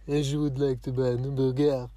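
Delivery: loud hammed-up French accent; mock French accent. Learner joking through a French lesson, mock French. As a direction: play at being French in English.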